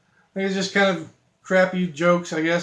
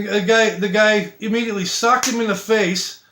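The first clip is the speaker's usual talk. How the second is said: ordinary delivery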